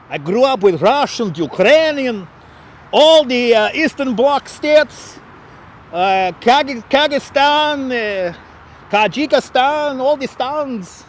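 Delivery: put-on slow, high-pitched Russian accent; exaggerated Russian accent, stretched vowels. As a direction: lay the Russian accent on thick, drawing out place names. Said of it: "I get the accent just right".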